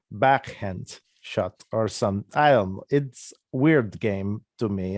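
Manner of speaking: put-on slow, halting Russian accent. Recording funny disc golf commentary as a foreigner, Russian accent. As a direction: play a Russian commentator unsure of American terms. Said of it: "in a Russian accent".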